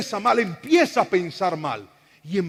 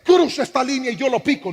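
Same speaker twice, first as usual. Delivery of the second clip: higher, louder street-tough challenge; mock tough threat; 5 semitones higher, 6 dB louder than usual. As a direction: bark it like a brawler picking a fight. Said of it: "Daniel no le dijo".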